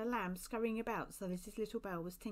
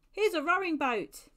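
loud, raised-pitch announcement; hearty, lifted announcement; announcing; 8 semitones higher, 10 dB louder than usual. A narrator's reading. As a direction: call out your find to the group. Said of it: "announced Joshua".

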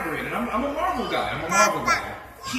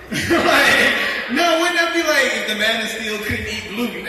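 words through laughter; laughing while talking; 4 semitones higher, 6 dB louder, slower than usual. Real, not acted. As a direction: giggle through the joke. Laughing at own joke.

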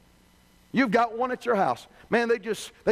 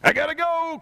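higher, louder, hurried embarrassed brush-off; flustered exit; 7 semitones higher, 3 dB louder than usual. Retold or imitated. Caught-out customer abruptly ends the call, higher and louder. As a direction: bail out of the call fast.

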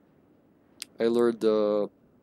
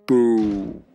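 booming imitation of a game monster; louder, drawn-out boomer call; deep, bassy; 2 semitones lower, 5 dB louder than usual. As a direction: boom out one long, deep "boo". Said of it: "Let's try it".

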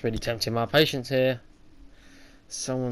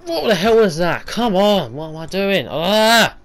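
frustrated yell; 8 semitones higher, 11 dB louder, slower than usual. Real, not acted. Frustrated gamer dying in level.

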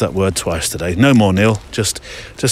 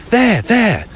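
imitating mum, very high and insistent; high-pitched mock urging; 13 semitones higher than usual. Mum urges the kid to look, insistent. Voicing mum pointing out planes.

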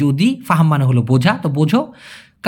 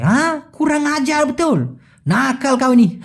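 drawled Malaysian Malay; 6 semitones higher than usual. Shown as a demonstration. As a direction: stretch it the Malaysian way, teasing. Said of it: "এইভাবেই কিন্তু টান দিয়ে বেশিরভাগ মালয়েশিয়ানরা বলে".

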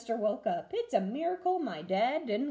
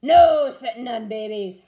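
much louder pirate chorus; crew holler; 13 dB louder than usual. A narrator's reading. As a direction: shout it like a crew. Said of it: "hollered the crew".

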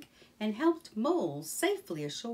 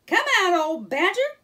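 loud, high call into the bush; 11 semitones higher, 12 dB louder than usual. Acted. Rat calls Badger out of the bush, loud and high. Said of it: "cried Rat".

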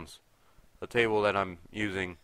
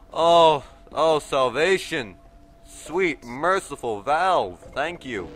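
mock reverent; 6 semitones higher, 8 dB louder, much slower than usual. Finding health supplies.

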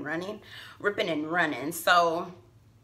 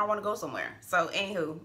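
ordinary talk

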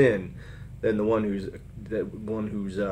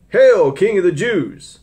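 mocking; 8 semitones higher, 8 dB louder, slower than usual. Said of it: "mocked him".

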